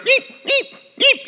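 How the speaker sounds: very high-pitched little yelps; imitated dog yips. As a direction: squeak like a begging puppy. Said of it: "yipping and whining".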